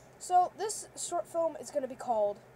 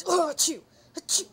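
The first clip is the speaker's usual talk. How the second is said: loud sneezes interrupting; fake sneezes; 2 semitones higher, 12 dB louder than usual. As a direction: sneeze twice, loudly. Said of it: "I need my sn".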